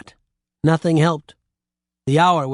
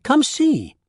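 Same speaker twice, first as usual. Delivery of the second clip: much higher, eager call; excited cry; 11 semitones higher than usual. A narrator's reading. Jill urging the old woman to look, excited cry. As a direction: pull her over with breathless eagerness. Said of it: "she cried".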